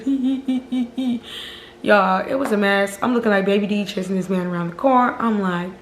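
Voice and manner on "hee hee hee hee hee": high mock giggle of the laughing friend; light hee hee giggle, mocking. Friend laughing at the couple's fight, mock giggle.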